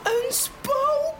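a friend shrieks at a ghost on the waves; scared shriek; frightened cry. A narrator's reading. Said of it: "roept een van de vrienden".

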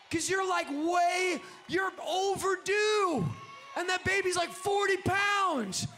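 high, shouted sermon cadence; full-force preaching. Real, not acted. Preacher telling overdue church to birth its vision, full-force preaching. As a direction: cry it out to the congregation, drawing out key words.

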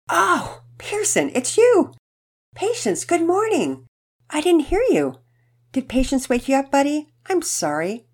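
high, bright cheerful mom; a surprised, cheery mother. Acted. Narrator voices Mama Peters.

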